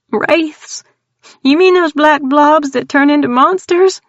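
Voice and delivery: put-on scared country girl blurting out her terror; frightened Texan woman. Tildy tells strangers about the clown, high frightened Texan voice.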